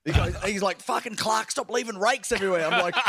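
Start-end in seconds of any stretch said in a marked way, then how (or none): 0.8-2.6 mock exasperated boss. Quotes the boss telling him off.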